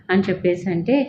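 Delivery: plain reading voice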